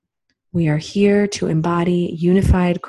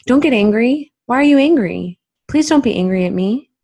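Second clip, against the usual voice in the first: lightly voiced plea not to be angry, higher and louder; mild mock pleading, pitched up; old family sayings; 5 semitones higher, 5 dB louder than usual. People from our life teaching us not to feel, lightly voiced pleading. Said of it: "how old these sayings are".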